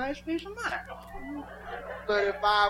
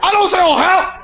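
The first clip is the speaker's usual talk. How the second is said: loud, higher waiter voice; loud, higher mock waiter; 9 semitones higher, 8 dB louder than usual. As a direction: play the offended waiter, loud. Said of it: "waiter voiced in story".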